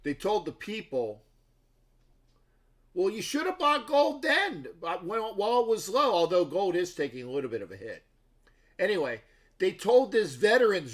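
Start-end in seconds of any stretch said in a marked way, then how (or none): 2.9-6.1 mock quote. Quoting film crew mockingly.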